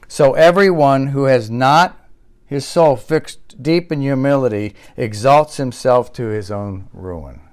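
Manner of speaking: slow, deliberate reading of the quote. Shown as a demonstration. Pastor reading Augustine slowly so the congregation hears it. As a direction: read each phrase slowly and carefully. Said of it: "I'm gonna read this slowly".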